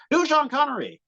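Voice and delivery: high-pitched shouted-out request; imitating a caller from the crowd; heckler's call.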